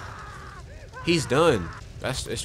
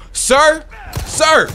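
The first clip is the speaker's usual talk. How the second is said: rising shout of sir; calling sir louder each time; 13 semitones higher, 9 dB louder than usual. Real, not acted. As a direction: yell to get his attention. Calling out to a villager.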